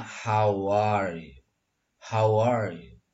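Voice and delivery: "how are you" as one joined piece, "howar-yu"; how are linked. Shown as a demonstration. Teacher reads it the same way, how are linked. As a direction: glide "how" into "are" without a gap. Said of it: "Dibacanya sama".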